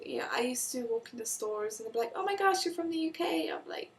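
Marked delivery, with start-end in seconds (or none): 2.1-3.5 higher-pitched gushing stranger; imitated excitement. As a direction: mimic a starstruck shop stranger, pitched up. Quoting excited strangers in stores.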